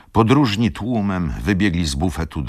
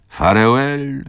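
"Farewell" called higher and louder, rising; calling farewell; 11 semitones higher, 5 dB louder, slower than usual. A narrator's reading. Wokulski calls "Farewell" to Izabela at the window, loud. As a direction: fling "Farewell" out to the departing train. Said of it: "zawołał Wokulski".

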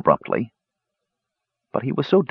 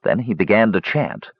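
usual speaking voice

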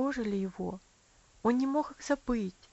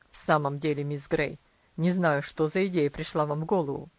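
exclaiming father; 7 semitones lower, 5 dB louder than usual. A narrator's reading. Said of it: "воскликнул мистер Динсмор".

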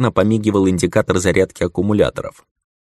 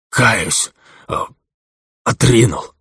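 wounded bandit; 4 semitones higher, 3 dB louder, slower than usual. A narrator's reading. Умирающий бандит кается.

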